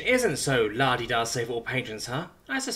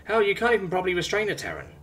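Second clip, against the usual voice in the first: higher, breezy teasing insult; playful berating; 6 semitones higher than usual. Acted. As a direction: needle him, almost playfully. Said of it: "Simone berates, almost playfully".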